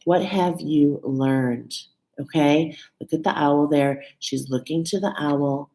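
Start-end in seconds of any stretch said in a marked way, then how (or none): 0.0-1.7 What have you learned? drawn out slowly, each word weighed; slow deliberate challenge; slow, pointed repeat. Shown as a demonstration. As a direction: repeat the question slowly so it sinks in. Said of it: "to really see".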